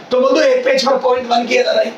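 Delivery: ordinary talk, nothing marked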